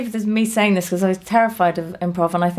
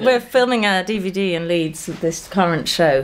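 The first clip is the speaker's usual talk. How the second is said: normal talking voice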